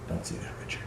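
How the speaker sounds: very soft, voiceless self-reproach; hushed telling-off of oneself; whispered aside. Real, not acted. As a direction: whisper it to yourself. Scolds himself under his breath.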